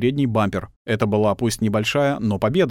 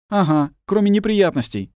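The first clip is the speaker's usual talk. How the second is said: grumbled; 10 semitones higher, 3 dB louder than usual. Acted. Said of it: "буркнул Вартанян".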